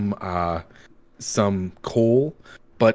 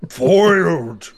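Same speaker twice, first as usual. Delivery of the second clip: foiled villain; 3 semitones higher, 5 dB louder, slower than usual. Acted. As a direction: cry it like a caught villain. Monster caught out.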